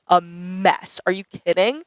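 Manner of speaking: usual speaking voice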